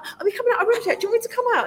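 high-pitched, over-keen asking; eager questioning. Retold or imitated. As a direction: call out like an impatient kid. Acts out calling to production staff.

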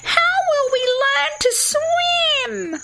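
very high, slow whiny wail; squeaky, despairing platypus kids; crying children. A narrator's reading. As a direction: whine it out like upset little kids. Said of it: "both the platypus children cried".

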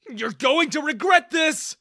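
loud high furious yell. Acted. Girl yells at Daniel, furious shout. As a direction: scream it as you're dragged off. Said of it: "I yelled at Daniel".

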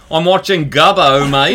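loud mock Australian drawl; Aussie accent. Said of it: "go full Aussie and be like".

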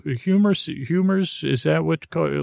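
normal talking voice

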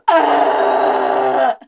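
loud retching grunt; hammed-up vomiting grunt. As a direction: heave it out loud. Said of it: "stood upright and went".

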